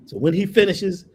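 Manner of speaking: ordinary delivery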